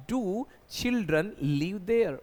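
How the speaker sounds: very slow, each word spaced out; slow dictation. Shown as a demonstration. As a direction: spell the sentence out slowly for students. (धीरे-धीरे, हर शब्द अलग)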